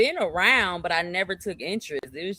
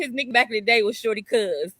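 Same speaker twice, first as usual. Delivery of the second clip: ordinary talk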